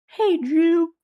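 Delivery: very high cartoon character voice; Carl Wheezer. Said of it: "Carl Wheezer".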